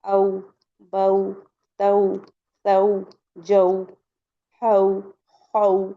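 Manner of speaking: each syllable a gentle glide from a into u; soft leen reading. Shown as a demonstration. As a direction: demonstrate "औ, बऊ, तऊ" one by one as soft au diphthongs. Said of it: "नरमी से पढ़ना है".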